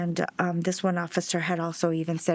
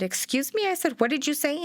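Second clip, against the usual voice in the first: pitched way up, incredulous Excuse me; stunned and outraged, demanding he repeat it; indignant disbelief; 8 semitones higher than usual. Retold or imitated. Mother confronting the cop who insulted her son, high indignant challenge.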